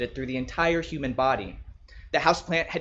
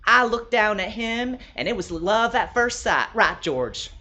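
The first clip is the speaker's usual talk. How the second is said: higher, doting woman, slower at the start; Brenda's voice; 6 semitones higher, 4 dB louder than usual. Acted. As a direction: gush to the fern like it's a sweetheart. Brenda addresses the fern.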